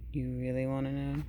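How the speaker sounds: low, quiet, ominous question. A narrator's reading. Bad seed asks listeners if they dare know, low and ominous. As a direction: drop deep and soft, daring them to ask. Seed teasing a dark confession.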